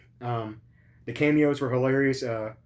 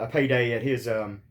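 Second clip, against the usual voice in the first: normal talking voice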